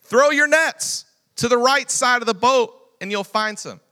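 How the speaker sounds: high, loud command across the water; calling out. Acted. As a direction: call it out from the shore. Jesus calling to boat.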